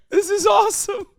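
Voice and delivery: high, loud laughing in disbelief; incredulous laughter. Real, not acted. Streamer reacting to an incel in office, shrieking laughter. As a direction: laugh through the words, half crying.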